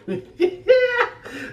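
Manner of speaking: shrieking high laugh; squealing laugh, way up high; high burst of laughter. Real, not acted. Reacting to eating crab.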